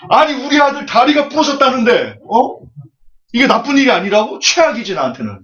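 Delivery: enraged, high-pitched protest; furious farmer. Acted. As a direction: yell at the sage over your son's broken leg. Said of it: "또 농부가 화가 났습니다".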